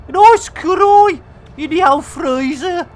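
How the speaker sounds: put-on high, loud, lilting Welsh accent. Streamer joking about curry in the freezer, playful Welsh accent. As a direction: put on a sing-song Welsh voice. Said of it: "stop doing that Welsh accent".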